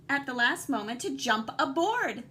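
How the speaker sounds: ordinary delivery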